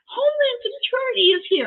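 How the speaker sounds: high-pitched mock wail into the phone; retold panicked crying. Wife phoning husband about officers outside, mock crying. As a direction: imitate your own tearful, squealing panic. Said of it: "And now I'm crying".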